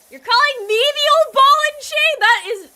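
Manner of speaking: high, loud, indignant mock shout; mock outrage. Answering chat calling her the ball and chain, high loud mock outrage. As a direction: fire back in hammed-up offence.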